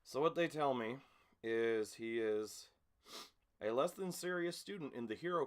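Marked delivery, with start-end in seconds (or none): none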